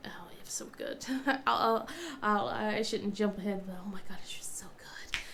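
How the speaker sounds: hushed whisper about the food. Real, not acted. Mom recalls the lasagna, soft whisper. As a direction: whisper it like a delicious secret.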